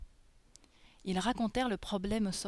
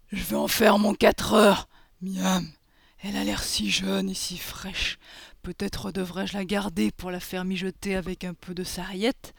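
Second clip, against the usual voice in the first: menacing ogre voice, savouring a meal; greedy villainous ogre; 5 dB louder than usual. Acted. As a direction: relish the captive like a hungry monster.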